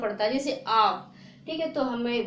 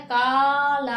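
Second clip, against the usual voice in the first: loud demo; 7 dB louder, much slower than usual. Shown as a demonstration. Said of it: "इसमें ये जोर नहीं लगता है".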